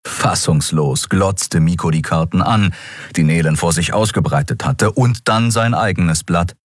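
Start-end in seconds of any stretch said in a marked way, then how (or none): none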